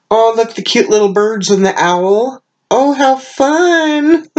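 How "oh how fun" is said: delighted squeal. Real, not acted. Delighted unboxing gifts.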